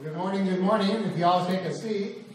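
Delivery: ordinary delivery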